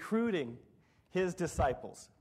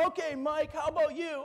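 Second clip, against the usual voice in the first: hyped manager; 9 semitones higher than usual. Retold or imitated. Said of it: "try to psych us up".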